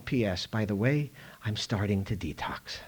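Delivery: ordinary talk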